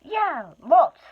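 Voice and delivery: high, loud blurt; a dangled hobbit blurting the truth; frightened hobbit. Acted. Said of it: "said Bilbo".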